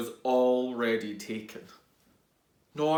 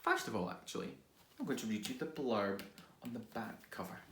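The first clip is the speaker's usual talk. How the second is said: ordinary delivery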